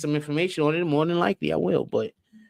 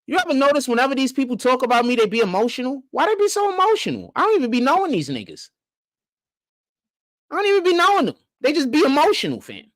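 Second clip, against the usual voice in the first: high-pitched, incredulous complaint; baffled exasperation; 7 semitones higher than usual. Real, not acted. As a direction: plead for an explanation, voice pitched way up. Baffled rant about critics' emotions.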